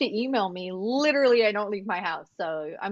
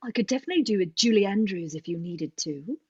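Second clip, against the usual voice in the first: put-on English accent; 3 semitones lower than usual. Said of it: "with that English accent".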